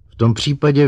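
high, loud burst; blurted out. A narrator's reading. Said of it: "vyrazila ze sebe doktorka Kelvinová".

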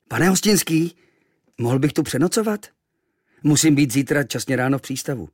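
lightly voiced eager boy; polite boy asking. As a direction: ask the innkeeper politely. Boy asks innkeeper for room.

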